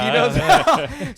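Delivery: high, loud, laughing mock quote; laughing imitation. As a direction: crack up while imitating him. Laughing while mimicking the crying man.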